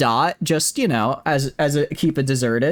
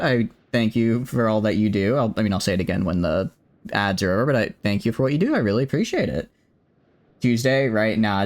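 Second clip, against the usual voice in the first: ordinary delivery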